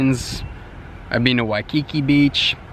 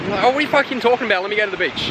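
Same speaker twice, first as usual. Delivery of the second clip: high, fast, fed-up outburst; half-joking fury at the guard; mock indignant rant; 8 semitones higher, much faster than usual. Australian to beach guard, fast mock outrage.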